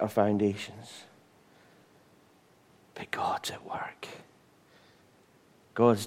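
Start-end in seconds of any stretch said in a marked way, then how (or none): 2.9-4.1 hushed, breathy whisper; a whispered reassurance to the congregation. Real, not acted. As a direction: breathe it like a secret. Repeated line, whispered.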